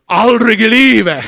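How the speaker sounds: high, loud indignant cry; little boy shouting no to the drunk; child's defiant shout. Retold or imitated. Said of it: "har jag sagt".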